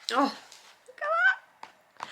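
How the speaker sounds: very high, slow gasp of delight; breathless admiring coo; delighted awe. Real, not acted. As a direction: swoon over the pretty picture. Admiring book illustrations.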